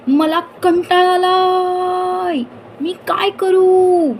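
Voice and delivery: put-on high, slow, drawn-out wail of a bored boy; bored child's wail. A narrator's reading. Boy wails to the house that he is bored. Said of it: "तो एकदम ओरडला".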